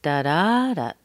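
"da" chanted weak-STRONG-weak; da-da stress pattern. Shown as a demonstration. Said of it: "put the da-das in there".

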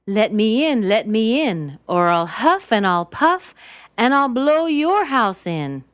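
loud, high-pitched wolf threat; storybook wolf calling out; big bad wolf. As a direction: demand entry, booming out "blow".

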